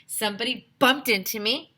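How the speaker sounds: ordinary delivery, nothing marked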